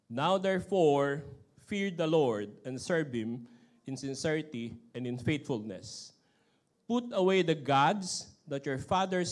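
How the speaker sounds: slow, solemn Bible reading; scripture reading. Acted. Pastor reads Joshua aloud to the standing congregation, slow and solemn. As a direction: read it out to the church with weight.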